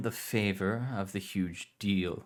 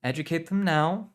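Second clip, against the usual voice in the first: higher, louder firm command; 10 semitones higher, 6 dB louder than usual. Acted. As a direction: say it firmly, leaving no room. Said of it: "uncharacteristically firmly".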